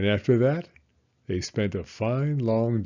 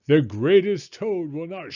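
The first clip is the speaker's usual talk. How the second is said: strange voice; 7 semitones higher, 7 dB louder than usual. Acted. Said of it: "Then the voice said".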